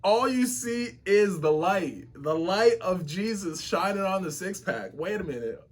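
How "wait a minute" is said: ordinary talk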